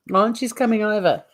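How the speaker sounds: ordinary talk, nothing marked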